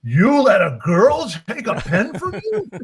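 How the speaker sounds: loud, high, drawn-out disbelief; outraged father. Retold or imitated. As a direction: imitate a dad incredulously scolding his son, slowly and loudly.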